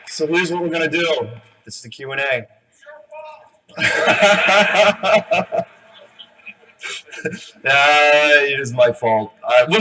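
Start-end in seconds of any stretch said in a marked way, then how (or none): 3.8-5.6 real laugh. Real, not acted. Laughs at the crowd's reaction.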